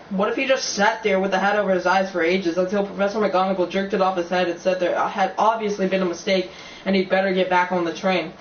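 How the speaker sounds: ordinary delivery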